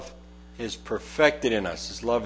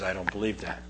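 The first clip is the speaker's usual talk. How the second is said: quiet skeptic; 6 semitones lower, 11 dB quieter, faster than usual. Retold or imitated. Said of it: "your attitude toward it is".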